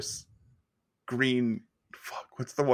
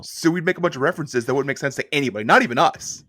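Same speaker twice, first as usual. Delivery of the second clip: fast, heated protest rising louder; worked-up yelling; 3 semitones higher, 5 dB louder than usual. Real, not acted. Co-host defending jokes to the messenger, worked up and yelling. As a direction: rant quickly and end on a loud high. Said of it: "You guys don't have to yell at me".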